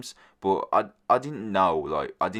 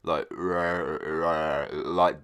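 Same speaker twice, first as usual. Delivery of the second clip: imitating her odd voice; 2 semitones lower than usual. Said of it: "she was kind of speaking in".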